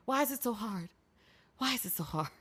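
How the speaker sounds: tired, soft why is it so hard; exasperated. Real, not acted. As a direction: drop to a weary undertone. Exasperated rant winding down.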